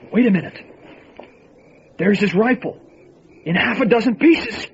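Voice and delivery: stunned by the shattered rifle; startled discovery. Acted. Deputy finds the smashed rifle.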